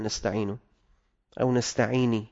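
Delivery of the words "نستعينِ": "نستعيني" ending in a clear -ni; kasra on nun. Shown as a demonstration. Teacher shows another harmless error, "نستعيني" ending in -ni. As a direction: say nasta'ini, with -i after the nun. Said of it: "بكسرها".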